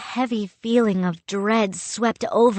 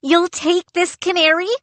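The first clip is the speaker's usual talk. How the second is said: disbelieving; 11 semitones higher, 4 dB louder than usual. A narrator's reading. Boy astonished.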